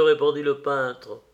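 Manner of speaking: plain reading voice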